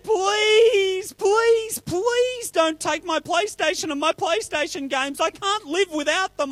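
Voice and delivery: high, whiny, hammed-up pleading; mock crying. Bob begging Fred to spare his PlayStation, mock crying. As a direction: wail and beg like a blubbering child. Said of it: "And Bob started to cry".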